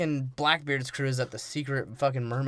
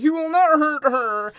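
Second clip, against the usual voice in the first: high, loud, slow drawn-out plea; hammed-up heroic priest protest; 11 semitones higher, 6 dB louder, slower than usual. Priest defending the mermaid from the pirates, hammed-up protest.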